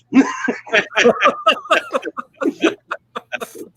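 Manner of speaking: high, long burst of laughter; big laugh. Real, not acted. Laughs at own puppet punchline.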